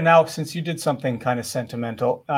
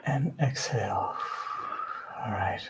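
soft spa whisper; 2 semitones lower, 6 dB quieter, slower than usual. Acted. Counts breaths for listeners.

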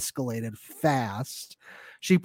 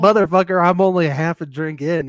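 mock angry retort; 4 semitones higher, 7 dB louder than usual. Kara voiced snapping back.

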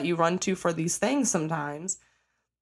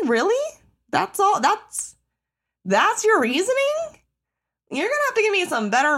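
incredulous outrage; 13 semitones higher, 3 dB louder than usual. Real, not acted. Calling out traffickers who do it for money, high incredulous outrage. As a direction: sound like you can't believe anyone would say that.

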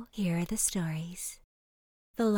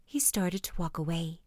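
ordinary delivery

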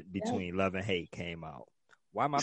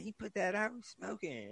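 high-pitched, softer complaint; mock polite lady objecting; imitated lady moviegoer; 11 semitones higher, 7 dB quieter than usual. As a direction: put on a high woman's voice, trailing softer. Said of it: "She's like".

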